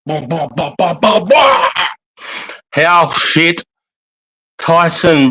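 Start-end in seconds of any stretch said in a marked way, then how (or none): 0.0-1.7 silly bah chant. Real, not acted. Goofy chant while waiting.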